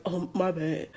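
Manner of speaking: lightly imitated sheepish sorry; quiet sheepish apology. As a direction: mumble it like you got caught. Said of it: "And he was like".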